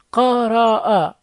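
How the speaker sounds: قرأ in three slow, separate syllables; sounded out slowly. Shown as a demonstration. Teacher drilling short vowels, sounded out slowly.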